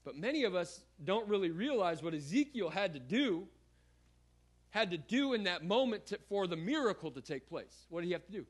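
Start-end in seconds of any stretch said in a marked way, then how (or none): none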